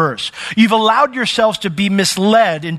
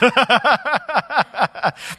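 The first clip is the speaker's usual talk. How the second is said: laugh; 7 semitones higher than usual. Real, not acted. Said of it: "that's kinda how it comes off".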